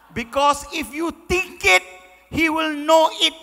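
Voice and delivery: loud mock retort. Son throws her words back.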